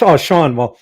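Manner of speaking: ordinary delivery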